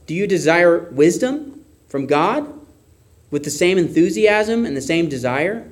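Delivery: ordinary talk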